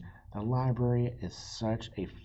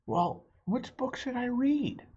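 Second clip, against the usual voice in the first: put-on higher, curious kid's voice; little boy Pete; 3 dB louder than usual. Pete asking the librarian what to do, high kid voice. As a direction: ask it wide-eyed like a small boy. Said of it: "asks Pete".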